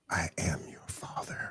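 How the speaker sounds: breathy whispered Vader line; Darth Vader quote. Acted.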